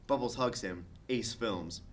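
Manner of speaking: ordinary delivery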